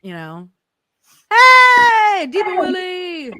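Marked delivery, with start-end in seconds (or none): 1.3-3.3 loud greeting. Real, not acted. Greeting a guest arriving.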